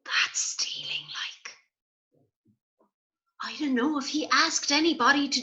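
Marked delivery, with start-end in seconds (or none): 0.0-1.6 hushed aside; whisper. Real, not acted. Reader judging the theft to the child, whispering. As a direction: whisper it like a secret. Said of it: "whispered aside".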